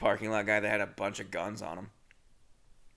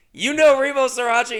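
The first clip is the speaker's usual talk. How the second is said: much higher and louder, thrilled disbelief; excited disbelief; 12 semitones higher, 10 dB louder than usual. Retold or imitated. Teller thrilled that the stranger knows his video, excited. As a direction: squeal it like you can't believe your luck. Delighted fan recognition surprise.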